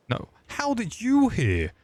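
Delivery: high squeaky character voice; squeaky put-on voice.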